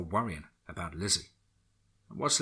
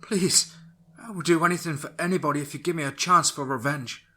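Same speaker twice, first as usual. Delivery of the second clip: desperate pleading; 8 semitones higher, 5 dB louder than usual. Acted. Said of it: "She began praying".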